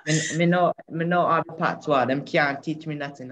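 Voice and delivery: cheeky Jamaican patois with a laugh; boastful patois. Shown as a demonstration. Boy boasts in patois that nobody can teach him. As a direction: brag in patois, half-laughing. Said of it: "Daddy must be talking some patois".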